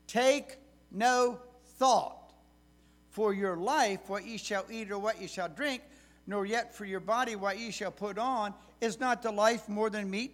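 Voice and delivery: lifted King James reading voice; scripture reading. Acted. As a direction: read the verses out with weight.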